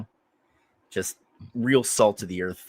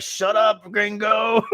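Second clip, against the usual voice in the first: neighbor yelling; 11 semitones higher, 4 dB louder, slower than usual. Retold or imitated. Neighbors pounding on the walls.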